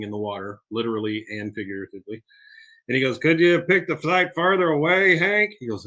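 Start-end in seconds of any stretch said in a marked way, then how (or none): 3.2-5.5 high, loud mock complaint; Dale. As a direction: push it up into an exhausted gripe. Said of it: "He is completely out of air".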